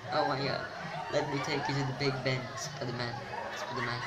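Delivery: loud, cracking up mid-line; laughing loudly. Real, not acted. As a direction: burst out laughing while repeating the joke. Cracks up at own joke.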